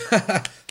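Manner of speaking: high little mimicked laugh; imitated delighted baby giggle. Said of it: "fucking stoked about it".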